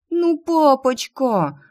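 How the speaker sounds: high, slow, drawn-out wheedling whine; pouting plea. Acted. Daughter wheedling her father with a pout, slow high whine. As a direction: pout and stretch "папочка" to melt him. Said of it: "надула я губы".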